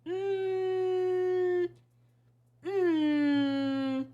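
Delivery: high doubting mm-mm; sceptical high hum. Real, not acted. Sceptical hum after quote.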